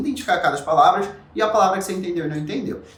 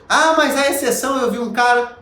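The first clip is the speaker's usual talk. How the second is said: higher, louder whiny-protest quote; raised-pitch imagined protest; mock objection; 7 semitones higher, 4 dB louder than usual. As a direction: play a doubter butting in with an excuse.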